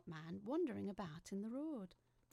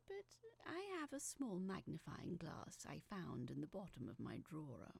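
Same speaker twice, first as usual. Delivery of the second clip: usual speaking voice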